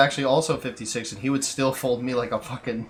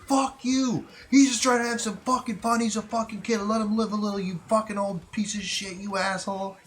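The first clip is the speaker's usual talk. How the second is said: higher mock shout at grandfather; imitating angry dad; 11 semitones higher than usual. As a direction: play the dad telling the old man off. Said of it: "my dad gets right in his face".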